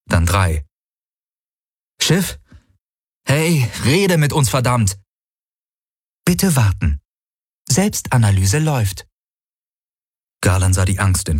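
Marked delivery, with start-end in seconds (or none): 2.0-5.0 high, urgent exasperated appeal; impatient demand. A narrator's reading. Crew demands the ship respond, impatient. 6.3-9.1 lightly voiced, higher status announcement; ship computer. A narrator's reading. Ship puts off the crew, computer announcement.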